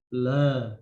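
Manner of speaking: very slow, very loud forceful chant; loud dhikr. Shown as a demonstration. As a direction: hit each syllable hard and loud. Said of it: "Suaranya juga keras".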